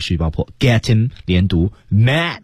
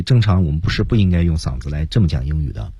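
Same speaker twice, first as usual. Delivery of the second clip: usual speaking voice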